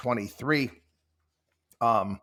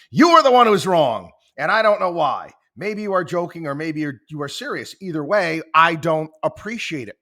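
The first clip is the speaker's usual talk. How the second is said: ticked off; 4 semitones higher, 8 dB louder than usual. Retold or imitated. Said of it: "Bing just starts getting ticked off".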